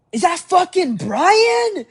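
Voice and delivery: high, drawn-out holler across a street; friend's shout. Retold or imitated. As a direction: yell it to someone far off.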